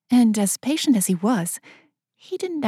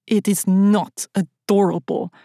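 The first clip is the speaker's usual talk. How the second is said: snapping. A narrator's reading. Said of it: "he snapped".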